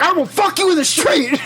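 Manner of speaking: high ranting conspiracy host; hammed-up unhinged radio rant; Alex Jones. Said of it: "go all Alex Jones on us".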